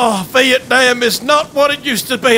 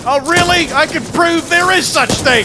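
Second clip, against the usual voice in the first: ordinary talk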